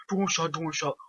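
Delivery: ordinary talk, nothing marked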